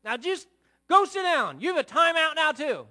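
hammed-up furious parent rant; mock angry father. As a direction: yell at the child like a harsh dad. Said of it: "Is that what I did?".

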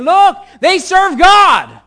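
very loud, high hollering; hype-man shouting. Acted. Follower advertising the men of God, shouting. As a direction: yell it out to every passerby. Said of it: "some person following him around and saying".

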